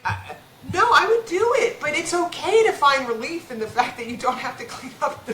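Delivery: high-pitched plea to herself, acted out; retold self-justifying protest. Vet arguing with her own guilt over feeling relief, protesting.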